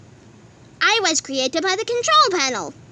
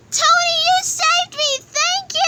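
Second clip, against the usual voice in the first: grateful gush; 13 semitones higher than usual. Acted. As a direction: gush thanks in a high voice. Grateful character.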